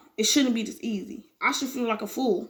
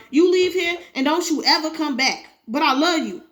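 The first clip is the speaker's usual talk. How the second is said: rising, louder anguished accusation; furious wife; 4 semitones higher, 4 dB louder than usual. Acted. Wife confronting cheating husband, furious and hurt. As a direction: hurl it at him, voice climbing higher.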